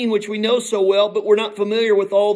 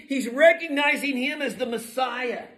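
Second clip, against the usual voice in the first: normal talking voice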